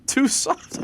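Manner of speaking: high, loud laughing echo; laughing disbelief. Real, not acted. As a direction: burst out laughing at it.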